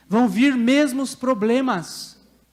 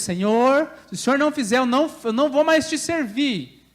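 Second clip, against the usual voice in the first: raised-pitch mock ultimatum; childish tantrum; 4 semitones higher, 4 dB louder than usual. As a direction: stamp out a demand like a child. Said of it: "como uma criança se jogando no chão".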